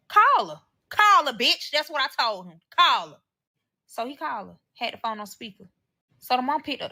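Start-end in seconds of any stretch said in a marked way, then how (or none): 0.1-1.7 loud, high-pitched demand; retold shouted dare; angry challenge. Retold challenge to call his mother. 2.8-3.2 loud retold demand; punchy, louder repeat of a challenge; angry challenge.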